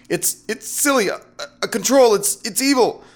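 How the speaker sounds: very high, halting protest; flustered stammering. A narrator's reading. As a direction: stutter out the objection, losing composure. Flustered man stammers while being seduced.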